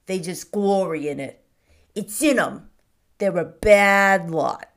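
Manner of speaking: put-on loud, gruff old shopkeeper railing at lords; a storekeeper spitting scorn at the nobility; Mr Hobbs ranting. A narrator's reading. Shopkeeper rants to Cedric about earls, loud and scornful.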